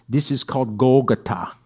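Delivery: hint of an Israeli accent on Golgotha; imitated Israeli accent. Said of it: "I love my friend Edon's accent".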